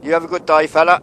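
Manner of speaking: loud, high call across the yard. Real, not acted. Vlogger hails the railway worker across the yard, calling out loudly. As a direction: hail him from a distance, cheerful and loud.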